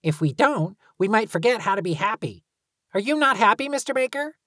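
put-on high, quick little girl's voice; child character voice. A narrator's reading. Talia voiced by narrator.

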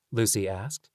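ordinary delivery

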